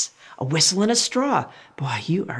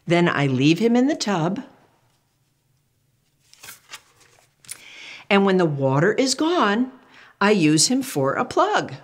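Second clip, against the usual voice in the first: put-on reading to children; 2 semitones higher than usual. Reads picture book aloud.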